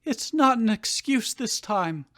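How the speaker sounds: crying mother. Acted. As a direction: beg him, a hint of tears. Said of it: "Delia cried".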